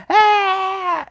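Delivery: very high hammed-up shriek; mock scream. Reviewer imitating the villain freaking out, mock shriek. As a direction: shriek like a panicking cartoon. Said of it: "screaming, like".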